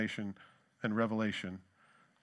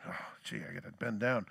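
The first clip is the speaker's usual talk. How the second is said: whispered, softer put-upon mutter; grumbling to himself; 5 semitones lower, 6 dB quieter than usual. Retold or imitated. Pastor dropping his car keys, whispered grumble. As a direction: sigh it out under your breath. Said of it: "and I'm like".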